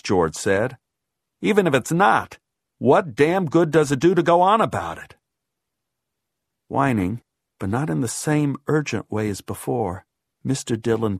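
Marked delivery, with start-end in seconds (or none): 1.4-5.2 raised, higher exasperated argument; angry dismissal. A narrator's reading. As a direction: argue it down with impatience. George argues back angrily.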